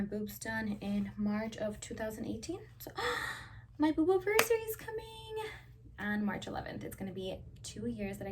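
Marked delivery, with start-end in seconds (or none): 3.0-3.7 high breathy delighted gasp; a sharp thrilled intake of breath; excited gasp. Real, not acted. Excited about anniversary. 3.8-5.4 much higher, louder gleeful squeal; high giddy announcement; excited. Real, not acted. Gushing about surgery anniversary.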